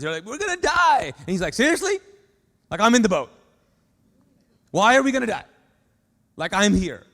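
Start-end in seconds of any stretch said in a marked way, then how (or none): 0.2-1.1 high, loud panicked wail; mock terror, disciples sure they will drown; hammed-up cry of panic. 1.5-7.1 loud, high, disbelieving; you can't be serious, I'm right here; hammed-up exasperation; incredulous. Jesus exasperated with disciples.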